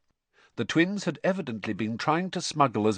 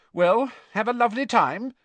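high, loud, brisk farewell; raised, pointed motherly goodbye; cross mother; 12 semitones higher, 6 dB louder than usual. A narrator's reading. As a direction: send them off still glowering. Cross mother sees family off.

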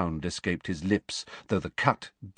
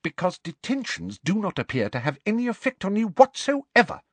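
high, tart teacher's retort; 13 semitones higher than usual. A narrator's reading. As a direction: put the pupil down tartly. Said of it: "said Professor McGonagall tartly".

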